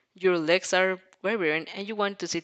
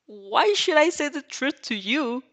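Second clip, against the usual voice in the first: defiant; 7 semitones higher, 4 dB louder than usual. Acted. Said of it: "You glare him out defiance".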